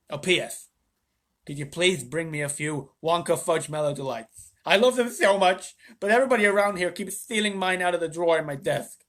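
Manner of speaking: a hint of the president's chatty voice; president writing. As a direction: read the letter as a fond, pleading president. President's letter read in character.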